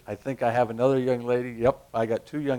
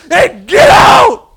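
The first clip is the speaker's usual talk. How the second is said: slow, very high, loud yell; hammed-up exorcist yell; drawn-out shout; 18 dB louder, much slower than usual. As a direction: stretch the shout out. Disciples shouting at demon.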